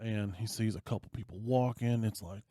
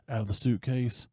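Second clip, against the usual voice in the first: usual speaking voice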